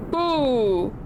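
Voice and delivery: usual speaking voice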